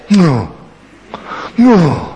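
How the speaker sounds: mock a sulky teenager refusing; lightly hammed-up teenage groan; grumpy teen groan. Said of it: "They go like this".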